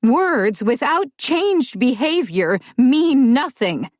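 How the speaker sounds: mother cutting off her daughter sternly; stern scolding. A narrator's reading. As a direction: lay down the law over the child. Mother scolds over crying child.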